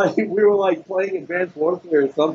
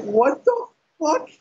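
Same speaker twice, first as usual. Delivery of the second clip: higher, slow stunned what the fuck; retold baffled reaction, pitched up and slowed; mock disbelief; 10 semitones higher, slower than usual. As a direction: drag it out in mock shock. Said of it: "I'm just like".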